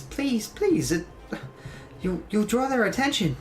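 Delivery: high, urgent pleading; nervous high-pitched plea; a little panicked. Acted. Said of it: "looking around a little bit panicked".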